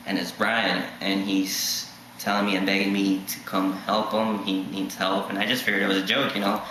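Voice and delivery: ordinary delivery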